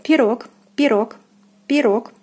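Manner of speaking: пирог with a full stressed "o"; careful model пирог, weight on о. Shown as a demonstration. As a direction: say пирог as "pi-ROK", falling. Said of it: "O is stressed and is pronounced as in the alphabet".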